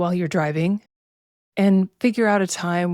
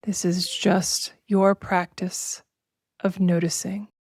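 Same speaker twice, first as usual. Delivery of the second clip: slow, measured meditation voice with long pauses; drawn-out, even-toned relaxation guidance; guided meditation; slower than usual. Acted. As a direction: guide listeners unhurriedly into body awareness.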